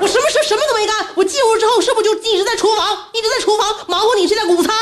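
high, aggrieved mock complaint; retold angry outburst. Mother re-enacting her complaint about kitchen work, high-pitched and indignant. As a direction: protest in a high, exasperated voice. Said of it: "我大声就控诉他".

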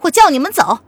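cold command. A narrator's reading. Lili on the cliff tells both men to leave, coldly. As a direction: order them away coldly. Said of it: "黎黎冷然的道".

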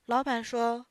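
usual speaking voice

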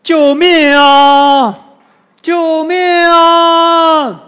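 high, long-drawn desperate shout; loud cry for help. A narrator's reading. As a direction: holler for rescue, stretching the words out. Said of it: "大声呼喊".

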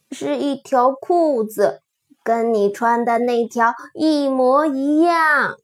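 plain reading voice

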